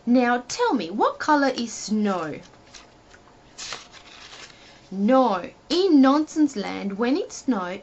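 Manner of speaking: ordinary delivery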